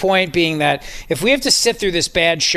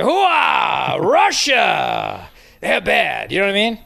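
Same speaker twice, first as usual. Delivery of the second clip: Al Pacino impression; much slower than usual. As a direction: bark it like a gung-ho colonel. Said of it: "we could have Al Pacino come out here and be like".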